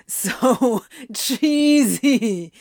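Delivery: slow, wheezing laughter; breathless, laughing complaint; dramatic wheeze. A narrator's reading. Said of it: "Crowley wheezed dramatically".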